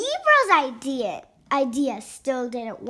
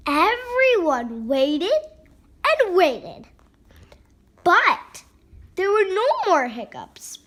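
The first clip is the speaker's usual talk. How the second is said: plain reading voice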